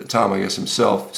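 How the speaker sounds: ordinary delivery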